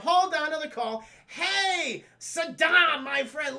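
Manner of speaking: high, loud mock-jovial hail; hammed-up phone greeting. Hobgoblin taking another call from his friend, hammed-up hearty greeting. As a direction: greet a crony with exaggerated gusto.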